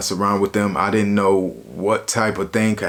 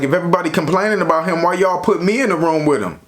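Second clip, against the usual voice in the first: retold indignant complaint; 6 semitones higher, faster than usual.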